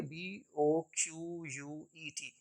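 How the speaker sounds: ordinary talk, nothing marked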